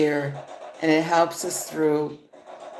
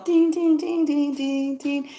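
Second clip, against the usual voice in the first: high chimed dings in a cat voice; ding like a toy bell, pitched way up; mock ding sounds; 10 semitones higher than usual. Imitating the reel's dings, high and playful. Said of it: "It's all".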